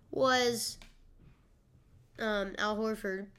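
ordinary delivery

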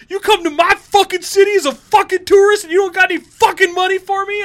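loud, high-pitched mock tirade; set off, enraged. Homeless man cursing the tourist who refused him, enraged yelling. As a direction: yell it as the furious panhandler. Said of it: "which then set him off going".

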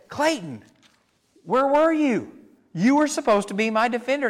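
mock indignant scold. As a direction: play the betrayed child, scolding. Scolding son in retold story.

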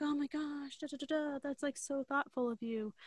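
high, soft, gushing; gushing girly voice. Retold or imitated. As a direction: swoon over the gesture in a high breathy-free coo. Gushing quote of her.

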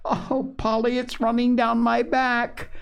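put-on high, whimpering small-child wail; subdued wail. A narrator's reading. Phronsie soaked with dishwater complaining to Polly, subdued wail. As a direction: wail it high like an upset little girl. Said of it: "breaking into a subdued roar".

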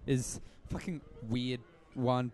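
ordinary delivery

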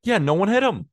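excited. Real, not acted. Laughing reaction to stat.

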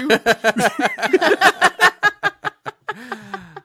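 loud, high, long laugh. Real, not acted. Teased speaker cracks up at the accent jibe, loud laugh.

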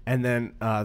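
normal talking voice

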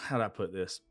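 ordinary talk

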